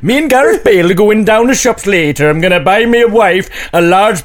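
put-on high, lilting amateur Welsh accent; comic put-on Welsh chatter. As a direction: keep up a shaky attempt at Welsh. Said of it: "It's a Welsh accent".

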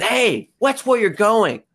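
hammed-up bully picking a fight; mock bully. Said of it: "this bully comes up and says".

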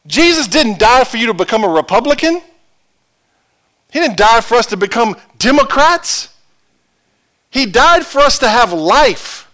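high, forceful sermon delivery; impassioned preaching. Real, not acted. Preacher on why Jesus died for us, impassioned preaching. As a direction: drive each point home to the congregation.

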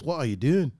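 quiet Scottish demo, ending downward; understated Scottish question; Scottish accent. Shown as a demonstration. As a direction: show a light Scottish lilt. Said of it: "so Scottish is".

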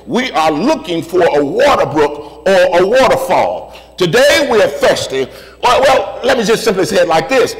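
emphatic sermon cadence, list rolling forward; rhythmic preaching. Real, not acted. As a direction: preach it in driving, repeated phrases.

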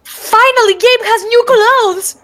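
high, slow, delighted exclaim; excited. Real, not acted. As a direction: squeal it out with joy. Excited fan outburst.

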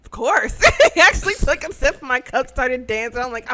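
ordinary talk

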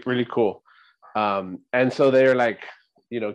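ordinary talk, nothing marked

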